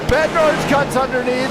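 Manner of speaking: usual speaking voice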